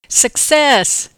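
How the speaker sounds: loud, high cry of success; triumphant alert. A narrator's reading. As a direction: announce a find triumphantly. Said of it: "I alerted him".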